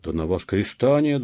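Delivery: loud indignant outcry; clamorous protest. A narrator's reading. Peasants protest to the police, clamorous. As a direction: protest loudly, one of a noisy crowd. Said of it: "зашумели мужики".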